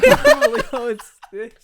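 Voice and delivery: high squeal through laughter; laughing squeal. Retold or imitated. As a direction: squeal it while cracking up.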